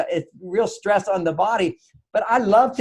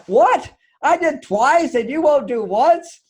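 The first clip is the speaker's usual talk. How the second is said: higher, louder and slow with disbelief; outraged she has to ask twice; indignant; 7 semitones higher, 3 dB louder, slower than usual. Retold or imitated. Wife protesting to husband about the vet trips, indignant. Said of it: "She was like".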